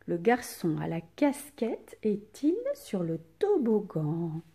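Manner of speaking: rising question tune, "est-il" marked, "toboggan?" lifted; question intonation. Shown as a demonstration. Teacher repeating the question so pupils hear the tune. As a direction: ask it as a clear question. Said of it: "Vous avez entendu ma voix?".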